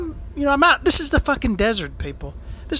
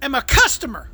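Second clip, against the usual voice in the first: angry yell; 7 semitones higher than usual. Retold or imitated. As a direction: blow up at the waiter. Angry outburst at waiter.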